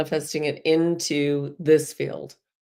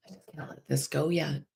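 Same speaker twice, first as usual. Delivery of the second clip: breathy whisper of a clinging thought; whispered inner thought; 10 dB quieter, faster than usual. Retold or imitated. Guide voicing the listener's stubborn thought in a whisper. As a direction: whisper it like a secret you're holding onto.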